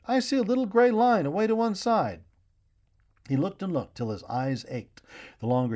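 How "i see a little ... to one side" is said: high, loud cry of discovery; boy spotting land calls out, high and loud; excited shout. A narrator's reading. Boy at the bow shouts to everyone, loud and high. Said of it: "he shouted".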